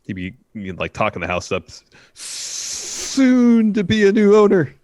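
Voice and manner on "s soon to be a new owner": breathy hushed yell, slow and high; drawn-out sales-pitch stage whisper. Pretend. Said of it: "Why are you whisper yelling at me?".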